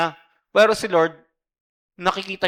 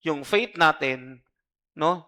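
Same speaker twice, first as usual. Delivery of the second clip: usual speaking voice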